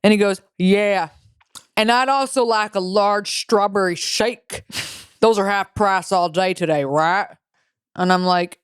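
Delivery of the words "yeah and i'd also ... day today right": imitated Southern drawl, slow and drawn out; southern accent. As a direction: play a slow-talking Southern customer. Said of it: "this guy with a southern accent goes".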